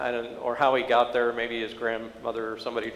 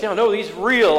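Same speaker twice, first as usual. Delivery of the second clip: put-on high, louder insistence of an awestruck teen; tearful awe, retold; 8 semitones higher, 8 dB louder than usual. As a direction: imitate a boy insisting through tears. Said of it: "tears rolling down his face".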